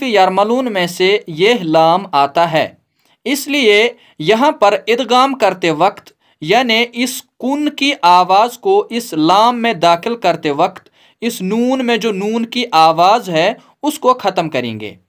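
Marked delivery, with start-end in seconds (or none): none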